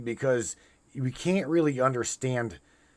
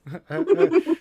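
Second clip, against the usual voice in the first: loud laugh; 7 dB louder than usual. Real, not acted. Laughing at his own joke.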